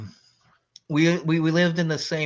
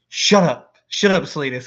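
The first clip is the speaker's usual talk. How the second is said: loud, high shut up; embarrassed shout; 4 semitones higher, 9 dB louder than usual. Retold or imitated. Caught-out roommate yelling at his friend, embarrassed shout. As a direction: shout him down, flustered. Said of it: "he's like".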